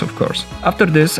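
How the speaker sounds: ordinary talk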